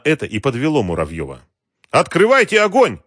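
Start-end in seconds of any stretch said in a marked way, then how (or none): 1.9-3.0 high, loud command; shouted order. A narrator's reading. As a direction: yell the order urgently. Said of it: "снова крикнул он". (громкий приказ криком)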